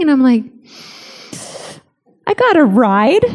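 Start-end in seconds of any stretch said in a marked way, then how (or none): 2.3-3.2 sheepish confession. Retold or imitated. Sheepish confession to alarmed husband.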